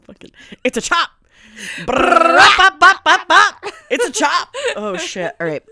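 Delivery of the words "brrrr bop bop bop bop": loud, high playful gunfire noises; spray imaginary bullets with your mouth; mock gunfire. Playing around, loud mouth gunfire noises.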